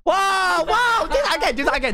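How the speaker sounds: high-pitched excited whoa; excited demand, pitch jumping up; excited outburst. Real, not acted. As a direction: burst out high, then ease down. Startled streamer reacting to camera.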